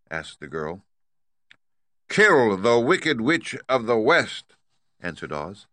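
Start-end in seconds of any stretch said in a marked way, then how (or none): 2.1-4.5 loud, high, slow commanding giant-head voice; booming great head. Acted. Great head demanding payment from Dorothy, loud and stern. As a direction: lay down the law from the throne.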